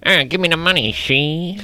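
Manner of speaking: lightly voiced Popeye, side of mouth; a hint of a cartoon sailor. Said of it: "a little Popeye sort of a situation".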